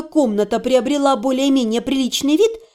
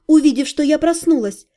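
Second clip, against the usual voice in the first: plain reading voice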